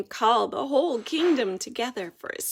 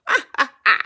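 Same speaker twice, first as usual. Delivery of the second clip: bat cackle; 3 semitones higher, 11 dB louder, much faster than usual. Acted. Bat gloating laugh.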